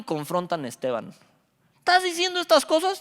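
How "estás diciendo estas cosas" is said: accusers' challenge. Retold or imitated. Accusers confront Esteban.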